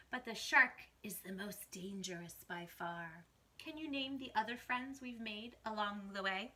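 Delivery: put-on lively read-aloud for kids, whispering the spooky bits; picture book rhyme, gently performed; story reading. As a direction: read to children, dropping to a hush for the mysteries.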